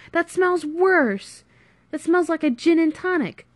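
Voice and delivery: high, loud, appalled outburst; exasperated complaint. Acted. Friend to friend over spilled tonic, appalled.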